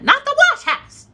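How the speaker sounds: high, loud mock shriek; mom's voice. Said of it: "But Mom".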